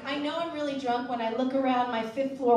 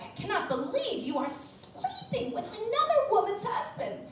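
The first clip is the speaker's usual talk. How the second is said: shocked friends; 9 semitones higher than usual. Retold or imitated. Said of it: "They were like".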